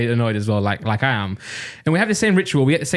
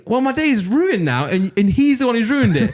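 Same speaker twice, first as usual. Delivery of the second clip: aggrieved; 7 semitones higher, slower than usual. Retold or imitated. Retold angry thought, blames passenger.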